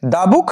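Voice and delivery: দা as "duh" before book. Shown as a demonstration. Teacher revises the consonant rule, দা as "duh". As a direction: open vowel in দা, then book. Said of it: "তার উচ্চারণ হবে দা".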